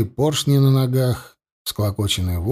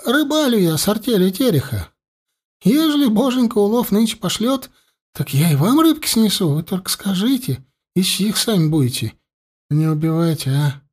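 высокий заискивающий голосок; робко заискивает перед разбойниками; запуганный мужичок; 8 semitones higher than usual. A narrator's reading. Мужичок упрашивает атамана. (запуганный мужичок)

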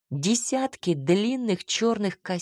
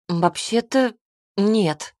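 ordinary delivery

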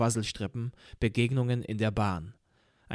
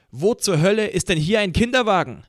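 loud angry shout; shouting back; 7 semitones higher, 7 dB louder than usual. A narrator's reading. Narrator shouting back at the woman on the train, loud and angry. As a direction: yell it back at her, furious. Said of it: "schrie ich zurück".

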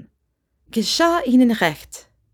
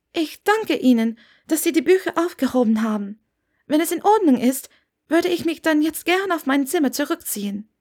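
lightly voiced young woman, higher, politely eager to leave; a higher, polite voice that wants out of the room; young woman Hermine; 7 semitones higher than usual. A narrator's reading. Hermine politely excuses herself to the landlady, lightly voiced higher.

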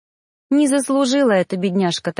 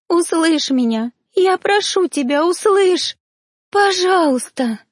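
much higher, slow strained pleading cries; voice-breaking plea; 8 semitones higher than usual. A narrator's reading. Girl begging her father to hear, voice breaking. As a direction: beg until the voice cracks. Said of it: "срывала голосовые связки".

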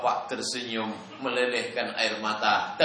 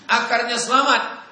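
excited relief; 11 semitones higher, 7 dB louder than usual. Pretend. Lega tanaman selamat.

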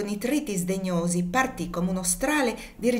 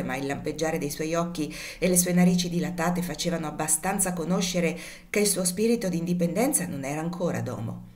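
usual speaking voice

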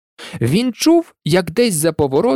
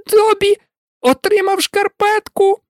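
overjoyed house-elf. A narrator's reading. Dobby overjoyed at receiving the sock. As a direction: squeal with disbelieving joy. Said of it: "не вірив своєму щастю домовик".

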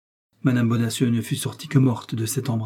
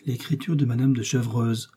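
usual speaking voice

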